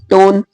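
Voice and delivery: "टोन" with the final e kept silent. Shown as a demonstration. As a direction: clip "टोन" with no vowel after न. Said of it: "इसे टोने नहीं बोलेंगे".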